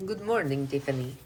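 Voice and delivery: a lightly put-on, sing-song greeting; odd little voice. Streamer greets a viewer in a lightly put-on voice. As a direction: greet her in a quirky, lightly funny voice. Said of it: "I don't know why I said it in that voice".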